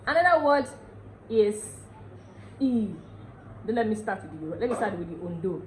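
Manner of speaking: ordinary talk, nothing marked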